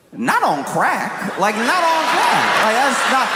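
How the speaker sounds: high-pitched, drawn-out mock disbelief; hammed-up incredulous protest, climbing in pitch; incredulous outburst. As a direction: stretch it out in escalating, high disbelief. Acted incredulous reaction to threat.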